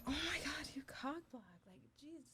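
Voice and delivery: hushed whisper of annoyance; whispered complaint. Retold or imitated. Annoyed at the friend blocking her, she whispers. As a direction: breathe it out under your breath, irritated. Muttering about the cock block.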